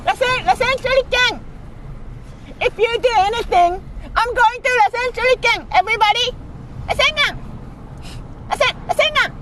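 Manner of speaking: anime shout. Pretend. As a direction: scream attack names like a cartoon. Shouting anime attack names.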